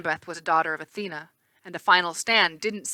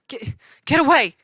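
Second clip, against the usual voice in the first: higher, louder panicked cry; 7 semitones higher, 6 dB louder than usual. A narrator's reading. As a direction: push her away in terror. Chris panics at Clarice.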